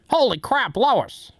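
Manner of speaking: a lightly voiced Peter yelping; Peter Griffin impression. Peter exclaims to his wife, high and goofy. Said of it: "I can't do any of those".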